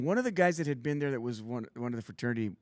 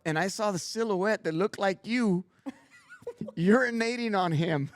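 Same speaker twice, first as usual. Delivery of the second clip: laughing; 6 semitones higher, 4 dB louder than usual. Real, not acted. Laughing while retelling the story.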